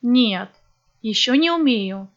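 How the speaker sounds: ordinary talk, nothing marked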